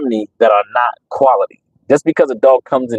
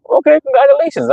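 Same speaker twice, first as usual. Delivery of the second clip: much higher, mock-cheerful okay, congratulations; sarcastic congratulations. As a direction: brush him off with fake cheer. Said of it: "I'm like".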